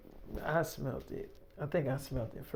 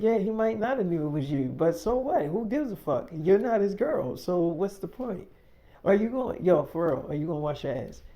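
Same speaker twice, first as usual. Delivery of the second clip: higher and louder, taunting and heated; loud, pitched-up confrontation; angry; 5 semitones higher, 5 dB louder than usual. Retold or imitated. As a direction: challenge her, rising in anger. Quarrel escalates.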